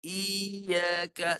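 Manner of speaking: Iyaka with the i stretched long; wrong long i. Shown as a demonstration. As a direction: drag out the i in Iyaka too long.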